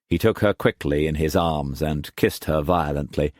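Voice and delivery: ordinary delivery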